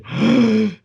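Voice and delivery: loud, high imitated gasp; big hammed-up intake of breath; starstruck gasp. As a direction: gasp like an overwhelmed fan. Imitates starstruck friend gasping.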